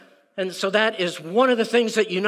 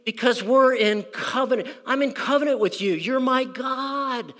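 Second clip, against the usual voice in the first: higher, pleading David; David begging for mercy, pitched up; voicing David; 6 semitones higher than usual. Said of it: "David looks up and says".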